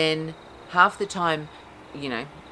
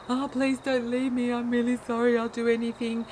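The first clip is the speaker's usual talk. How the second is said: higher, pleading clingy voice; 7 semitones higher than usual. Retold or imitated. Enmeshed partner begging not to be left, pleading. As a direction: beg them not to leave you. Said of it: "I'm gonna turn around and be".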